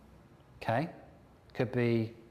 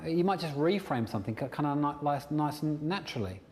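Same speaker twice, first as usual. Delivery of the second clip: ordinary talk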